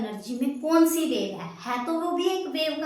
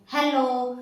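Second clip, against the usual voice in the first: raised-voice "hello" as a demo; louder, firmer demonstration of speaking up; loud hello; 4 dB louder, much slower than usual. Shown as a demonstration. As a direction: project hello as if into a microphone. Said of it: "मैं बोल रही हूँ बिल्कुल तेज".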